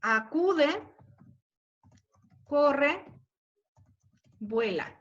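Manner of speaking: acude, corre, vuela marcando cada acento; ritmo trocaico. Shown as a demonstration. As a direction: scansion lenta, sílaba por sílaba. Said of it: "que es el trocaico". (acentos marcados en "Acude, corre, vuela")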